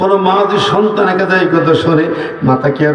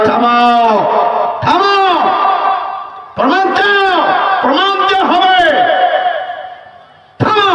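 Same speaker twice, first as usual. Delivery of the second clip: high ringing cry of stop; commanding shout; 11 semitones higher than usual. Acted. The Prophet ordering Walid to stop, ringing shout. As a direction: thunder the command down.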